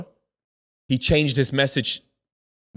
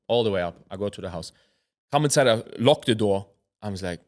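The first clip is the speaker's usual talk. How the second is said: normal talking voice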